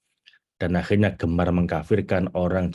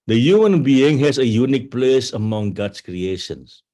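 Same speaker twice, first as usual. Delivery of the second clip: slow, louder, deliberate English reading; slow solemn reading; 4 dB louder, slower than usual. Shown as a demonstration. Teacher reading the twenty-first rule of love aloud, slow and solemn. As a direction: read it like scripture, unhurried.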